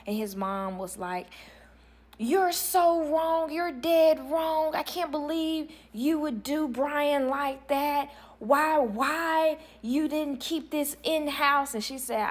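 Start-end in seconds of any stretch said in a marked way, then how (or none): 2.2-11.7 slow, high-pitched wail of reproach; imitated mother lamenting, high and drawn-out; aggrieved mother. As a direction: drag each word out in mock outrage.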